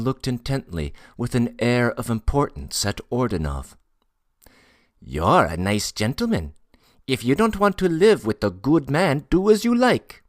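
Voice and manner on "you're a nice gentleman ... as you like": insistent Tatar porter. Acted. Porter telling a gentleman to do as he likes, increasingly insistent. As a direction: voice climbing high and loud.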